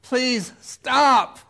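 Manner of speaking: loud, high cry to stop; desperate plea. Acted. Worn-down believer begs it to stop, desperate. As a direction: plead at full voice.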